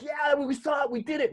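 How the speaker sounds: high, thrilled whoop of triumph; giddy high-pitched victory cheer; excited cheer. Retold or imitated. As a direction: celebrate like you just found it. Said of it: "And you're like".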